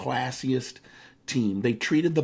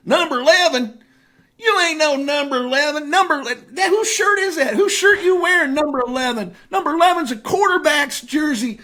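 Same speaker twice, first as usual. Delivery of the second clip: Larry Bird; 7 dB louder than usual. Retold or imitated. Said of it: "hick from French lick accent".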